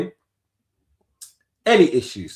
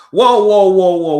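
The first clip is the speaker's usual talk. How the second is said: surprised whoa; 6 semitones higher, 11 dB louder than usual. Real, not acted. Reacting to chat's jibe.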